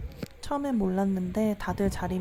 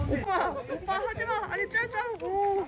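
shrieking excited squeal; 12 semitones higher than usual. Real, not acted. Big catch, squealing at brother.

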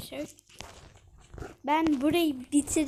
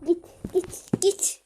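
higher, louder urging, trailing into a whisper; urgent shooing; 6 semitones higher, 6 dB louder, slower than usual. Real, not acted. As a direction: shoo it away urgently. Urging something away.

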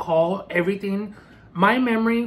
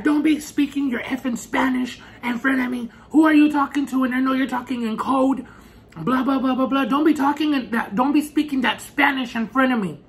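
higher and louder, a furious jealous rant; angry yelling; 7 semitones higher, 4 dB louder than usual. Retold or imitated. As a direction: snap at her for speaking Spanish. Boyfriend yelling about Spanish.

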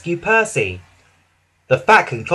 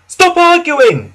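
high, loud, slow shout; impatient cry; 14 semitones higher, 10 dB louder, slower than usual. Acted. Passengers shouting at the arguing crew. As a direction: cry out at them to stop. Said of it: "cried the passengers".

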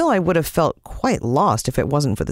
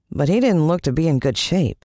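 usual speaking voice

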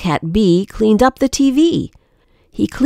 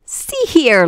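plain reading voice

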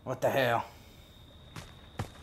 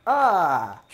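startled yelp; 5 semitones higher, 8 dB louder than usual. Real, not acted. Sudden yelp mid-thanks.

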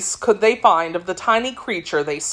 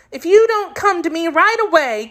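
put-on high girlish voice, mildly cross; getting provoked; 11 semitones higher than usual. A narrator's reading. Girl threatening her disobedient kitten, provoked. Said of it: "getting provoked".

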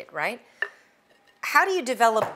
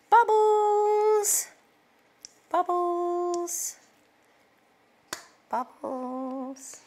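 excited squeals; 11 semitones higher, much slower than usual. Real, not acted. Cook delighting in risen dough bubbles, excited high squeals fading. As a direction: squeal with joy at the bubbles, then trail off.

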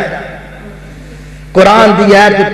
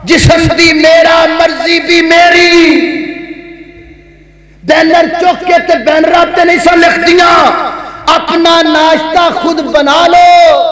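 high, sustained chanting delivery; declamatory preaching; 7 semitones higher than usual. Acted. Preacher mocking women's protest slogans to the congregation, high declamatory chant. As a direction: ring out each phrase on one high note, stretching the endings.

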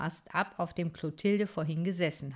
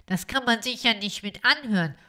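louder, gruff interruption; 4 semitones higher, 5 dB louder than usual. Acted. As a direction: cut him off rudely. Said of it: "ziemlich ruppig".

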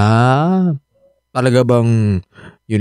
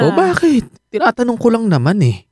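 defensive; 11 semitones higher than usual. Acted. Husband teasing about wife's ex.

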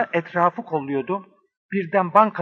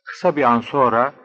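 low, measured storyteller; low-pitched adventure narration; detective story narration; 4 semitones lower than usual. Acted. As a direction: narrate a crime thriller in a lower voice.

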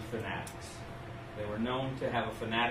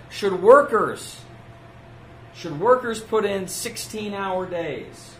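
booming rousing general speech; 5 semitones higher, 4 dB louder, slower than usual. Retold or imitated. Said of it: "He said".

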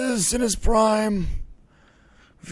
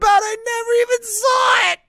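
much higher, louder outcry; shouting at a newly found surprise; excited shout; 7 dB louder than usual. Acted. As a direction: yelp it out, overjoyed and amazed. Sudden discovery of Jeff's thing.

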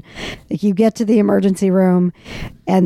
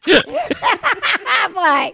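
high, slow, stretched out through laughter; laughing disbelief; 11 semitones higher, much slower than usual. Retold or imitated. Retelling her reaction to the doctor's name, laughing disbelief. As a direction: stop everything, barely able to speak for laughing.

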